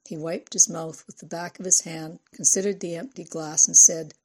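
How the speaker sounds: usual speaking voice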